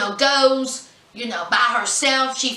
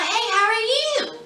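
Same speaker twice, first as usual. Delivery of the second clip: sweet mom voice; 9 semitones higher than usual. Retold or imitated. Mom greets on phone.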